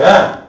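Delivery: short, loud angry "ah"; imitated dad's yell. Dad's whole vocabulary, a loud mock yell. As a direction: burst out with one wordless yell.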